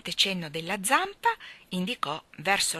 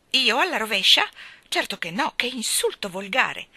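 louder, higher outraged protest; indignant; 4 semitones higher, 6 dB louder than usual. A narrator's reading. Old elephant protests to Jane, indignant and loud. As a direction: bristle at the insult.

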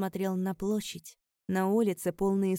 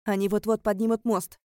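loud warning call; urgent shout; 3 semitones higher, 8 dB louder than usual. A narrator's reading. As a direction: shout a warning that time is short. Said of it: "крикнул Кадис".

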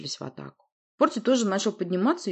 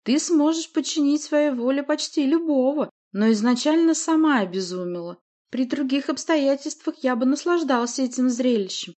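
high, raised gloating monster; smug monster; 8 semitones higher, 5 dB louder than usual. A narrator's reading. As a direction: explain it with gloating ease. Voices monster Рыг in story.

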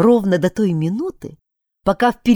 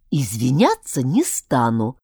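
hissed refusal; 6 semitones lower than usual. A narrator's reading. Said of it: "прошипел Альберт".

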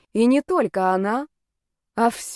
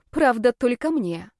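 ordinary delivery, nothing marked

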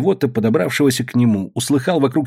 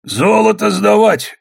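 high, slow, drawn-out proclamation; official announcement; 9 semitones higher, 4 dB louder, slower than usual. A narrator's reading. Оломов ordering the miners, slow high proclamation. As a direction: announce it to all, stretching the words. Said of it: "объявил Оломов".

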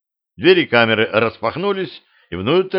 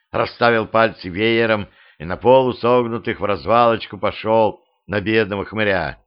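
usual speaking voice

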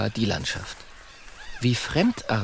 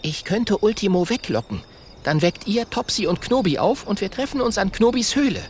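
put-on higher eager young voice; young dragon voice; 9 semitones higher than usual. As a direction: lay out the plan. Kokosnuss voiced.

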